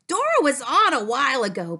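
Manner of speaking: usual speaking voice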